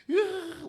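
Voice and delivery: loud, high mock baby cry; sudden high-pitched bawl, put on; imitated newborn wail. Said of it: "울어요".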